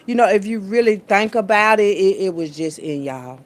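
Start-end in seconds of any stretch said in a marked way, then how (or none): none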